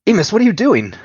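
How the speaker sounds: near-scream of disbelief at a friend; panicked shout. Acted. Nico's alarm peaks.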